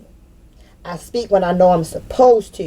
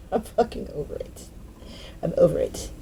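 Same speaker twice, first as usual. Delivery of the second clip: breathy whisper, almost no voice; 13 dB quieter than usual. Real, not acted. Done with the drama, whispering I'm over it. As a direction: let it go in a hush.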